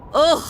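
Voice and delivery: loud, high shudder of disgust; real shudder of disgust; disgusted ugh. Real, not acted. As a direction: recoil at a bad memory. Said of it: "I'm just shuddering".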